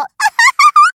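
short, very high and loud rising laugh; shrill mocking laugh. Mistress bursting out laughing at her shameless pet, very high and loud.

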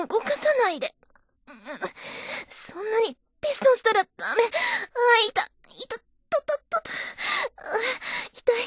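weeping, breaking cries of hurt; tearful pain. Acted. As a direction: sob out the pain. She whimpers in pain.

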